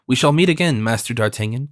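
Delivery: ordinary delivery, nothing marked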